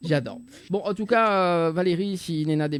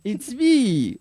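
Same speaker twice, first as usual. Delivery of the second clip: a bright, higher teasing "hey, it's me"; playful mock intro; 8 semitones higher, 6 dB louder than usual. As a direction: tease her like a pop star saying hello. Repeats the teasing catchphrase. (présentation taquine de star, voix plus aiguë)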